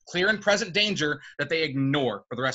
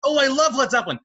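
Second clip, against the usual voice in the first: higher and louder gushing fanboy; mocking Peter Parker; 9 semitones higher, 6 dB louder, faster than usual. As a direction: gush like a dorky kid.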